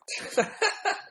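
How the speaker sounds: high, breathless burst of laughter; helpless laugh. Real, not acted. Vlogger embarrassed by his Irish accent, real helpless laugh. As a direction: crack up at your own attempt.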